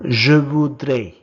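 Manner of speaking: first take, projected clearly for the class; loud model word. Shown as a demonstration. Teacher modelling a French word for learners, loud and clear. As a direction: call out the French word loudly. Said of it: "you say I would like in French as".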